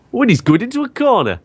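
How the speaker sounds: high, squeaky commentator impression; John Hindhaugh impression. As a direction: pitch it way up like a bad impression. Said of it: "That sounds nothing like him".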